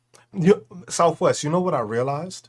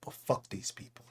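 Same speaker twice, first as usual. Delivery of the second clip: softer, breathy voiceless curse; hushed curse; 5 semitones lower, 13 dB quieter than usual. Real, not acted. Streamer quietly cursing the people before the list. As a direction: spit it out under your breath.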